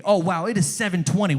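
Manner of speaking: normal talking voice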